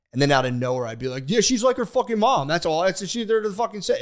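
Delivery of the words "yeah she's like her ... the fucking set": re-enacted outburst, high and loud; angry blurted accusation. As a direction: blurt out a mock tirade, pitched way up. Acted blurted attack in counseling.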